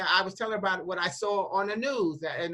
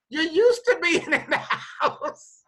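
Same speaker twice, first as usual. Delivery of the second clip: higher, louder, breaking into laughter; laughing retort; 8 semitones higher, 5 dB louder than usual. Retold or imitated. Retelling a chat with one of her mothers, laughing retort. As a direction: crack up while scolding fondly in a retelling.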